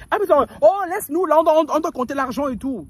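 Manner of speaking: high, loud shooing voice; hammed-up uncle shooing her away; mock uncle waving off the woman; uncle's voice. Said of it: "Il me sort".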